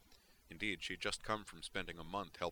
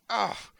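high, loud cry of disgust; outraged yell; 13 dB louder than usual. Acted. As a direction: explode in frustration. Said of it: "let out an outraged yell".

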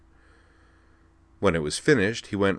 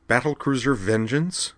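blurted; 6 semitones higher than usual. Acted. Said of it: "she blurted".

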